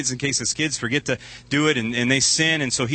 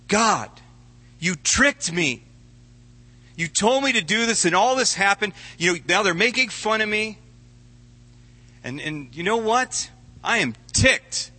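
angry complaint; 7 semitones higher than usual. Acted. Jeremiah complaining angrily to God.

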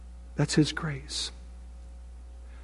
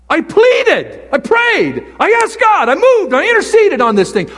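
much higher, loud, urgent begging; desperate pleading; 12 semitones higher, 6 dB louder than usual. Retold or imitated. Paul begging God to remove his thorn, high and loud. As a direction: beg God to take it away. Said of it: "And Paul says".

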